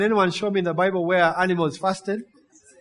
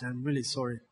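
quiet apology; 8 semitones lower, 13 dB quieter than usual. Pretend. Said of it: "voicing repentant believer".

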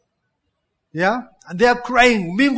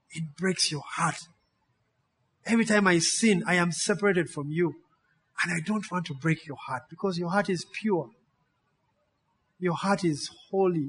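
hushed prayer; 7 dB quieter than usual. Acted. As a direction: speak to God softly, humbly. Preacher voices a quiet prayer.

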